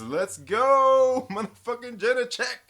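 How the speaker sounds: high, loud cheer with a laugh; excited, high-pitched holler; hyped shout. Acted. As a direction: yell it like a hyped-up fan. Acting out viewer's hyped chat message.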